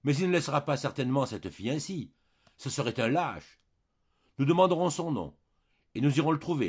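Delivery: moved, growing animated. A narrator's reading. Jeanne argues back to her husband, moved and animated. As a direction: warm to the argument with rising fervour. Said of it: "émue, s'animait".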